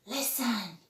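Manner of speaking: usual speaking voice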